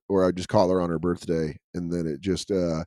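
ordinary talk

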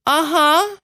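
put-on high, rising little-girl uh-huh; eager-sounding child's yes; not very grudging child. Shown as a demonstration. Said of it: "that wasn't very grudging".

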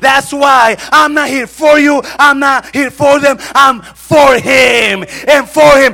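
high, shouted sermon delivery; full-force preaching. Acted. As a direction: thunder out God's reassurance to an embattled believer. (prédica a gritos, con voz aguda y potente)

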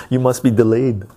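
loud teasing jab; mock insult. Real, not acted. Host jokingly insulting a chatter, loud and teasing. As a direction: jab at them for fun.

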